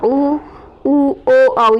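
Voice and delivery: teacher models उ, ओ, औ one by one for pupils; rounded-lip vowels उ, ओ, औ said clearly apart; vowel demo. Shown as a demonstration. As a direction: sound each vowel उ, उ, ओ, औ on its own, lips rounded. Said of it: "जैसे आप उच्चारण करके देखिए".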